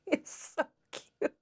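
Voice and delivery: high, slow gush over something adorable; helpless high-pitched fawning; cute-overload squeak. Real, not acted. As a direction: squeak it out, overcome by cuteness. Gushing over the plushie.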